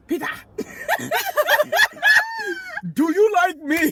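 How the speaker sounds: put-on loud Nigerian accent cracking with laughter. Eight-year-old asking Peter out, loud Nigerian accent. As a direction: belt out the note in a thick Nigerian accent. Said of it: "I still had an accent from Nigeria".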